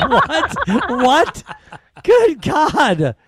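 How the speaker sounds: high, loud incredulous outcry, retold; astonished disbelief. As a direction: act stunned by a sudden insult. Retells reaction to sudden insult.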